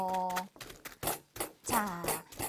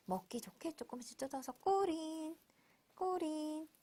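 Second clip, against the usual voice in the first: whispering; 2 semitones higher, 8 dB quieter than usual. Real, not acted. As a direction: hush it like a secret. Whispered instruction to child.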